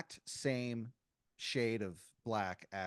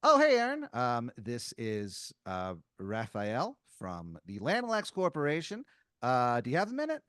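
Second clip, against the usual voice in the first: chirpy corporate caller; 6 dB louder than usual. Acted. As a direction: open an awkwardly friendly business call. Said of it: "you hear this, uh, this voice go".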